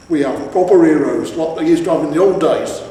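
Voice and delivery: loud boastful troll voice; proud troll. A narrator's reading. Troll boasting to his son, loud. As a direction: puff up about proper heroes.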